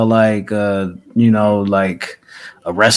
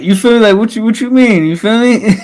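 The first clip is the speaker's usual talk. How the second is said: higher and laughing agreement; laughing, excited; 10 semitones higher than usual. Real, not acted. Laughing as he agrees with his co-host about Eddie. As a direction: egg him on, cracking up.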